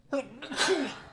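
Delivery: high sharp sneeze; sneezing. Real, not acted. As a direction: let a real sneeze out. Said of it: "Je vais éternuer".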